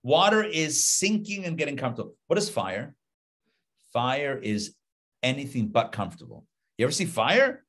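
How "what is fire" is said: ordinary delivery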